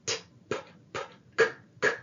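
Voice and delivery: "t, p, p, k, k," as bare unvoiced puffs, no vowel; voiceless consonants. Shown as a demonstration. Teacher has students feel no throat buzz, unvoiced puffs. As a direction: breathe out each consonant with no voice. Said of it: "when you say t".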